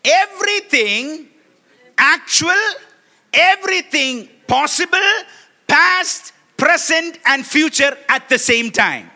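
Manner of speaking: slow, loud, high preaching cry; fervent pulpit shout. Acted. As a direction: proclaim it with full force.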